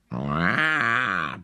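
a short grumbling bear-cub roar. A narrator's reading. The cub refusing his supper, a small roar. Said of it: "brüllte er".

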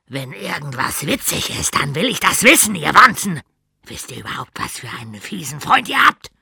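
loud bullying coach voice; a coach who shouts at his students; gruff gym coach. Acted. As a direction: gym teacher shouting at kids he calls bugs.

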